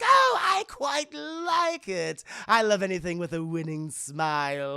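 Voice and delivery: slow, theatrical villain purr with a laugh; a slow, gloating supervillain drawl; Joker voice. Retold or imitated. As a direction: play the Joker savouring the meeting. Joker answers Bruce at table.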